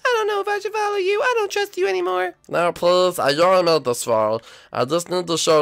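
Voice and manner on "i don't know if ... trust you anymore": high cartoon voice, distrustful; suspicious Toshi. Toshi refusing to believe, high voice. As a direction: brush it off as a trick.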